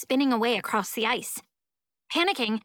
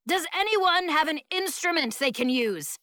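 higher-pitched, understated yell; mild yell; 6 semitones higher than usual. A narrator's reading. Teacher asks stranded kids for an instrument, mild yell. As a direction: call out with a touch of a yell. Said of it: "Mister Pinwhistle yells".

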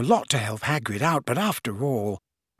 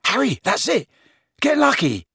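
high, eager exclamation; excited; 12 semitones higher than usual. Acted. Ron urges Harry with a new idea, excited. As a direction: burst out with a brainwave.

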